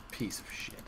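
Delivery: breathless whispered curse; whispered insult. Real, not acted. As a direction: hiss the insult under your breath.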